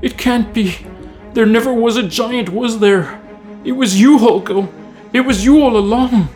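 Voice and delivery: high, distraught outcry; horrified realization. Acted. Servant realizing his master is the giant, distraught.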